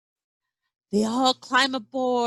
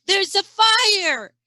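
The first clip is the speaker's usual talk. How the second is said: gasping alarm; 9 semitones higher, 4 dB louder than usual. A narrator's reading. Gasps at the fire.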